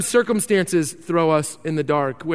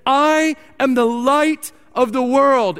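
higher, louder, slow, stress on I; slow, emphatic quote; 5 semitones higher, 4 dB louder, slower than usual. Acted. Preacher reads Jesus' claim aloud, slow and proclaiming. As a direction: proclaim it, leaning on I. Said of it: "Jesus says in verse twelve".

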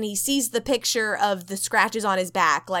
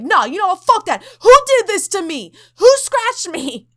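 much higher and louder, furious; hammed-up tough guy yelling at ghosts; challenging ghost angrily; mock furious yell; 9 semitones higher, 10 dB louder than usual.

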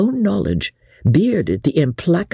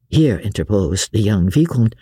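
plain reading voice

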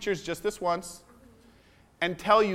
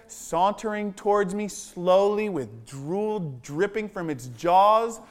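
slow suspense telling; slower than usual. Real, not acted. Suspense in the dragon story.

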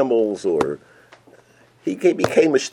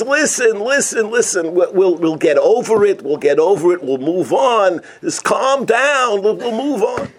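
mild soothing; 10 semitones higher than usual. Acted. Husband calms his wife.

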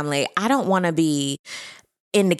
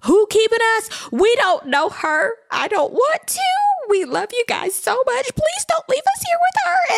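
high-pitched mock child pleading; imitated upset kids; 13 semitones higher than usual. Kids begging parents not to leave them, hammed-up high plea. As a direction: play panicked children begging, voice climbing much higher. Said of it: "the kids are like".

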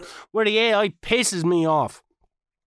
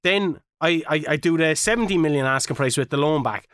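ordinary delivery

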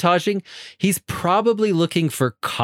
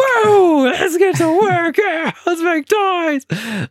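high, loud, drawn-out mock whoop; hammed-up manic shriek, very high; manic drugged-up frenzy; 5 dB louder, much slower than usual. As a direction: imitate someone wildly overstimulated, squealing to start work. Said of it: "the way he acts when he drinks".

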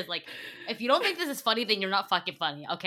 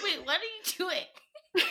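laughing quote; 8 semitones higher, much slower than usual. Retold or imitated. Acting out own amused reaction.

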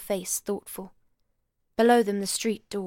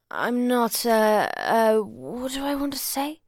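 put-on drunken slur; 3 semitones higher, 5 dB louder, slower than usual. A narrator's reading. Drunk husband rambling.

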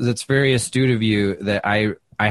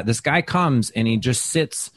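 normal talking voice

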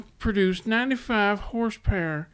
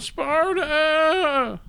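higher, louder war cry; battle cry; 6 semitones higher, 5 dB louder than usual. Retold or imitated. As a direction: shout it like a warrior.

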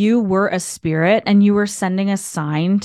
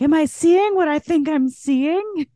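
disbelieving surprise; 9 semitones higher than usual. Retold or imitated. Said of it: "rub my eyes and be like".

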